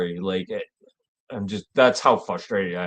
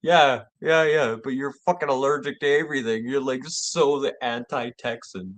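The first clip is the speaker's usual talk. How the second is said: mocking retort; 6 semitones higher than usual. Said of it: "[name]'s retort".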